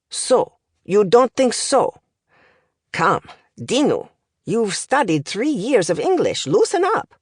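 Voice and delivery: put-on accented tutor chiding his pupil, pitch jumping up; impatient language professor. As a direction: urge the stiff student on with exasperation.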